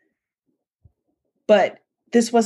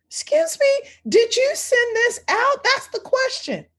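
stern mom. Retold or imitated. Mom's stern retort in story.

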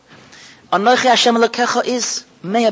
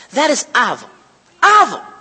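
usual speaking voice

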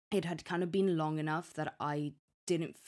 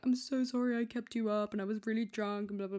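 higher, sheepish apologetic voice; retold apology; 6 semitones higher than usual. Girlfriend apologizing to her annoyed boyfriend, higher and sheepish. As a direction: act sorry the morning after. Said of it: "And I'm like".

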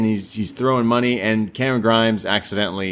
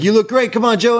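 high, louder pleading; eager kids; 13 semitones higher, 5 dB louder than usual. Retold or imitated. Kids begging Joe to stay, high and loud. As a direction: coax him like excited fans. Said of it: "they're like".

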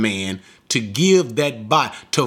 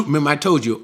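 normal talking voice

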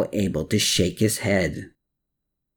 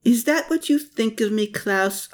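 joking; 12 semitones higher than usual. A narrator's reading. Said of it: "Anna jokingly asked".

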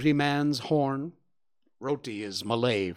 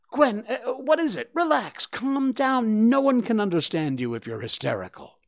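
calming father; 10 semitones higher, 4 dB louder than usual. Acted. Papa calms panicked Gwen.

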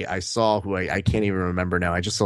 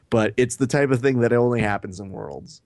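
normal talking voice

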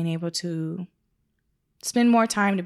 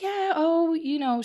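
higher, breezy voice; light mock sweet; 9 semitones higher, slower than usual. Retelling the Ferndale photographer, lightly voiced sweet tone. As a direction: play the sweet colleague.

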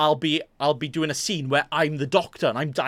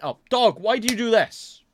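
exasperated; 7 semitones higher, 3 dB louder than usual. Real, not acted. Dog interrupts the recording.

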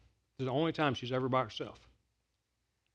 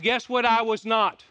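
normal talking voice

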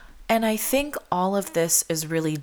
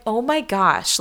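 higher-pitched, heartfelt gush; touched exclamation in a raised pitch; touched and honored; 7 semitones higher than usual. Retold or imitated. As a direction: gush it to a dear friend, pitched up. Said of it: "it is my honor".

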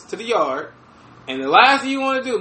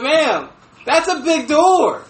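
slow disbelief; 4 semitones higher, much slower than usual. Retold or imitated. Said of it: "I'm like".

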